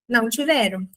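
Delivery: usual speaking voice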